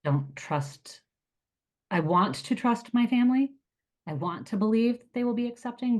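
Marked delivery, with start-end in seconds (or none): 1.9-6.0 emotional plea. Real, not acted. Confessing distrust of family, emotional.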